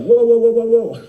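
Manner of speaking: loud, high "whoa" backing off; alarmed stop. Retold or imitated. Husband recoiling from Jesus' list of demands, alarmed.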